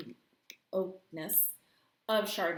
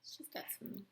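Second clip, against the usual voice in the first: whispering; 3 semitones lower, 14 dB quieter than usual. Real, not acted. Whispered remark.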